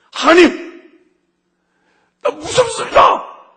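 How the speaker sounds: very high, loud anguished shout, slow; a man screaming his fear to God in prayer; desperate cry to God. Retold or imitated. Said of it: "소리 지르고 우는 저를 발견한 거예요".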